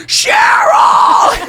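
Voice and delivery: sudden, very high full-force scream. Retold or imitated. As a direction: explode into a long piercing yell. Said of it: "just started screaming again".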